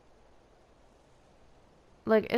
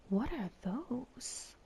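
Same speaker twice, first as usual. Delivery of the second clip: slow hushed whisper; 2 semitones higher, 8 dB quieter, much slower than usual. Real, not acted. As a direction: breathe it out unvoiced. Spooked by strange sight.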